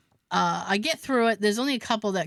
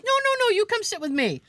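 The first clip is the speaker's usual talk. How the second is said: insistent Bill; 11 semitones higher than usual. Retold or imitated. Bill insisting she sits.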